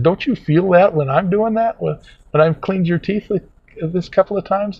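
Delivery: imitated assistant, high insistent question; dental assistant challenging. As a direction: press the point, pitched well up. Assistant challenges him in retelling.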